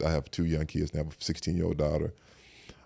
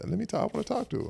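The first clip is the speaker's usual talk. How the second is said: high, fast, pressing to talk; eager retold plea; 3 dB quieter, faster than usual. Retelling his call to the mother, high and eager. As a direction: rush it out, pitched up and insistent. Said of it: "I said".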